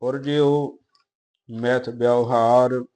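slow, measured Gurbani reading; even, deliberate verse reading; scripture recitation. Acted. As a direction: recite line by line with drawn-out vowels.

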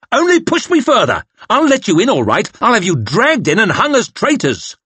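high, loud raging threat; furious threat. Acted. Officer threatening the petitioners, raging and loud. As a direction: threaten them in a fit of temper. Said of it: "thumping at the table in a fit".

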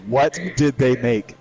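slow, clear model sentence; slow teaching demo. Shown as a demonstration. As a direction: spell it out for a learner. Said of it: "Say it with me".

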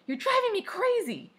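high, loud exasperated shout. A narrator's reading. Sister shouting at her brother in a squabble, shouted. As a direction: yell it up high at a sibling. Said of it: "And I shouted".

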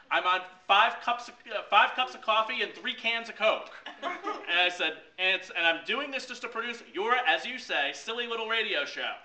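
loud, high, wound-up rant; frazzled rant. Retold or imitated. To his boss about the radio show, a loud high frazzled rant. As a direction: rattle it off like a man on five coffees.

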